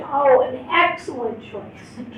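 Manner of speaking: normal talking voice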